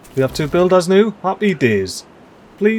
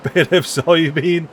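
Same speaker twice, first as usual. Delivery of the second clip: ordinary talk, nothing marked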